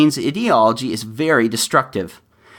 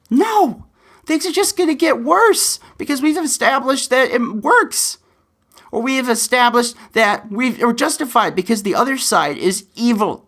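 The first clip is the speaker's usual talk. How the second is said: passionate alarm; 10 semitones higher than usual. Real, not acted. Passionate warning about escalating rhetoric.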